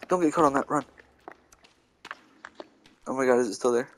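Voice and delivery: ordinary talk, nothing marked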